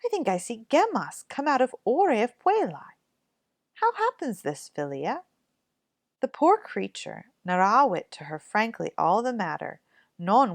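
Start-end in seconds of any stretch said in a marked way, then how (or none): 0.0-5.2 astonished. Acted. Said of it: "quite astonished".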